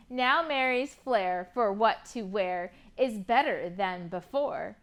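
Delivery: rhyming picture book read with lively lilt; reading to children. Acted. Teacher reading a rhyming book to kids, lively. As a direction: read the rhymes to a class of kids.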